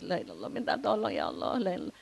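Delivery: very soft murmured plea; tearful dhikr imitated. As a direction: pray under your breath, as if weeping. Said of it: "Sambil nangis". (zikir lirih menirukan orang menangis)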